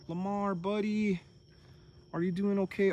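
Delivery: high, gentle coo to a sick animal; tender pet talk. Real, not acted. Owner checking on her sick goat, high tender coo. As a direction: soothe him like a scared pet.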